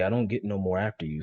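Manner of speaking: ordinary talk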